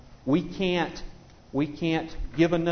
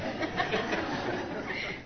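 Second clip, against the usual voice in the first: soft laugh; 8 dB quieter than usual. Real, not acted. Speaker laughs at joke.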